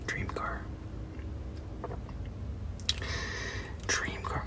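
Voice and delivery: dream car, breathed without voice; whisper. Real, not acted. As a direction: whisper it like a wish. Whispered adoring repeat.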